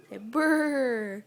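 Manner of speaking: lips buzzing brr like freezing; shivering brr. Shown as a demonstration.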